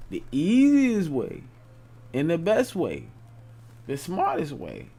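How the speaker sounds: excited emphasis. Real, not acted. Building the key point.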